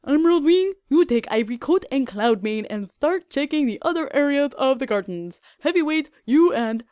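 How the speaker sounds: captain barking orders at guards; barked orders. Acted. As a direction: bark out the assignments. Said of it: "as he barked out his orders".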